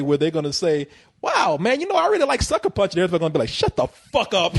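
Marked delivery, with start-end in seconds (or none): 1.2-2.9 put-on much higher, fast stoner-kid gush; mock dude. Imitating future college kid at party. 3.5-4.5 slow, flat put-down from the crowd; drawn-out crowd put-down; mock dismissal. Everybody at party replies.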